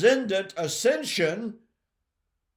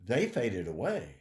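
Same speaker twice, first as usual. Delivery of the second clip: quiet; 8 semitones lower, 5 dB quieter than usual. Shown as a demonstration. Repeats the line in a new way.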